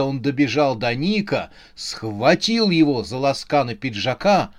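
ordinary delivery

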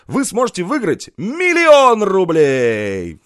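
loud, slow, drawn-out prize announcement; TV shopping host. Pretend. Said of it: "ведущего телемагазина".

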